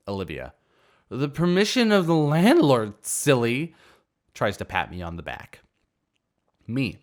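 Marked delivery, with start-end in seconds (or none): 1.1-3.8 put-on high, loud, sing-song drunk voice; drunk girl. Drunk party girl talking down to the owner, high and loud. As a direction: play the tipsy party girl.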